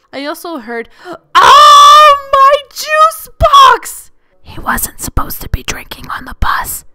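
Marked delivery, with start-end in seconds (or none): 1.1-4.1 high loud slow mock wail; kid's dismay. Kid's juice box mishap. 4.4-6.9 tattling whisper. Retold or imitated. Kid tattling quietly.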